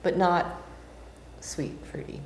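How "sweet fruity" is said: whispered, much softer. Real, not acted. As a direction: drop to a whisper. Tasting notes, contrasts with previous.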